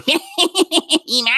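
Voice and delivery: high cackle into a villainous cry; put-on high evil voice; Skeletor. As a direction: cackle and screech like a cartoon villain. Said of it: "they're not gonna go".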